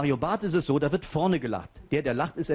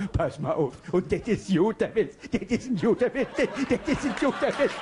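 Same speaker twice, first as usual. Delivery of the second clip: Mario Barth impression; 2 semitones higher than usual. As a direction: tell the joke laughing at yourself first. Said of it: "Der erste Prominente ... Mario Barth".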